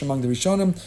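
ordinary delivery

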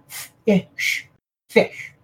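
f, I, sh as separate sounds, then fish; short "ih" for I between bare f and sh, blended into fish; sounding out phonics. Shown as a demonstration. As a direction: spell fish out sound by sound, softly. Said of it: "You could out the other end".

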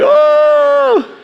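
high startled oh of mock fear; mock fright. Pastor on a ladder mocking his fear of heights: high startled oh. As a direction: squeal a playful frightened oh. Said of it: "I'm afraid of heights".

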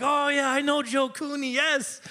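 imitated excited Irishman, high-pitched; light up at a familiar name, pitch jumping; high, eager Irish-accented outburst; all excited. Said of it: "and he got all excited".

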